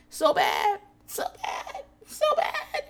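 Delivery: hammed-up, squeaky fake crying, breaking up; mock agonized moaning. Host mocking a patient in pain, overdone tearful moaning. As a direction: whimper it melodramatically, mocking a drama queen. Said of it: "You can't even just moan and be like".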